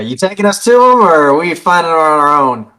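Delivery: in-character question. Acted. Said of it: "I'm gonna turn to Elizabeth and say".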